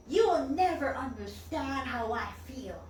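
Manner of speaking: acted-out bitter protest; grumpy old man. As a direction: push them away as an upset patient. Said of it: "he was a really grumpy old man".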